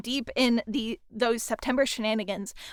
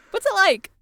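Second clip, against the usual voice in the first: pitched way up, louder, teasing; playful repeat; 9 semitones higher, 5 dB louder than usual. Shown as a demonstration. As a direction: toss the question back, higher and louder. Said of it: "I ask this as the second person".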